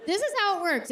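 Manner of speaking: ordinary delivery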